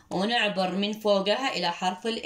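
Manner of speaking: ordinary delivery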